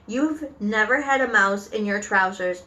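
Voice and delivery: ordinary delivery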